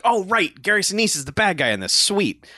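sudden happy recognition, played up a little; pleased realization. Host retelling when the actor showed up, pleased realization.

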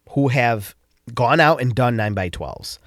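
normal talking voice

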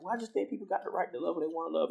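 high, quick coworker voice; imitated coworker. Acting out coworker's rebuttal.

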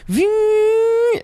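high imitated whizz; board noise. Storyteller imitates the hoverboard passing, high. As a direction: make the whoosh sound up high. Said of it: "we hear".

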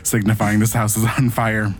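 normal talking voice

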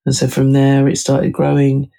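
ordinary talk

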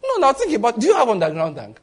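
ordinary talk

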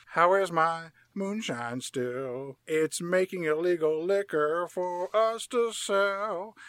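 put-on slow, drawn-out Southern old-timer. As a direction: stretch every word in a lazy country drawl. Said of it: "And Uncle Jesse says".

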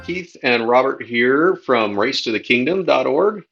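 ordinary talk

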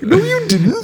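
high laughing "no, you didn't"; mock disbelief. Viewer calls out the bragging guy, squealed laughing retort. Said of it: "And I'm like".

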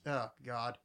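low, soft groan; mild dismay. Retold or imitated. Host reading notes on The Genesis, quietly dismayed. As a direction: sink into quiet dread.

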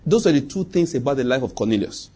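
ordinary talk, nothing marked

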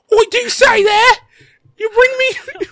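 mock British squeak, laughing; bad British accent. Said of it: "impersonate Steve the sound guy doing a British accent".

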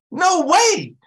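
high, loud "No way"; emphatic rejection. Real, not acted. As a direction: shoot it up in disbelief. Preacher rejecting skipping Thanksgiving.

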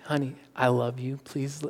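soft, tender plea; gentle. Retold or imitated. Husband hugging his wife, soft gentle plea. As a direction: hold her and ask gently, softly. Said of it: "trying to be as gentle as possible".

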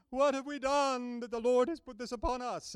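put-on a touch of old-movie melodrama, lightly wailed; melodramatic crew. Preacher voicing silent-film sailors in the storm, mildly melodramatic. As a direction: ham up the panicked sailors, mildly. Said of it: "really melodramatic kind of things".

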